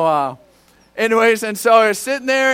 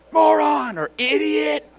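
mock-irritated higher-pitched outburst; irritated name-calling; 8 semitones higher, slower than usual. Driver at someone who annoyed him, irritated. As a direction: blurt insults at another driver. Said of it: "my favorite word was moron".

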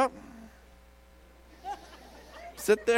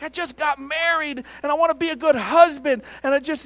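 high, loud earnest plea; imitated crying out; 8 semitones higher, 6 dB louder than usual. Newly married man crying out to God, retold plea. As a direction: push it up high and loud, pleading. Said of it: "he's just crying out to God".

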